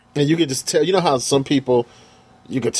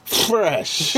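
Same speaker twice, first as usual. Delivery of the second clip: mocking; 7 semitones higher than usual. Real, not acted. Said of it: "You make it sound so gas".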